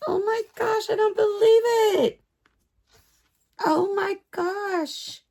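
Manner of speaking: very high, slow, near-tearful squeals; overjoyed squeal. Real, not acted. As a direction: melt into giddy disbelief. Overwhelmed by the calendar.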